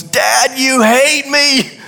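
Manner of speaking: put-on high, slow wail of a small boy; a hint of a kid's cry, pitched high; mock child scream. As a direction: lightly play the five-year-old yelling. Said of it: "He screams at the top of his lungs".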